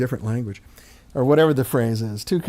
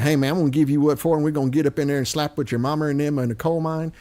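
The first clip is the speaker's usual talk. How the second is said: put-on Missouri hillbilly accent; faster than usual. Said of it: "When he talks like".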